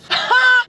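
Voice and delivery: sudden high laugh; burst of laughter. Real, not acted. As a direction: crack up laughing. Laughs at pink Smurf.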